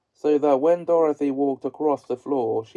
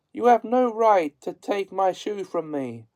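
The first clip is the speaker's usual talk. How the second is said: put-on girl protesting; 6 semitones higher than usual. A narrator's reading. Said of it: "cried Dorothy".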